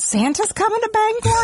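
excited. Retold or imitated. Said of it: "I would always misread them as".

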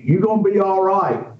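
a hint of a local man promising he'll be fine; rural neighbor reassuring. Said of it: "and he says".

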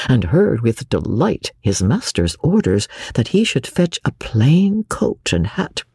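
ordinary delivery, nothing marked

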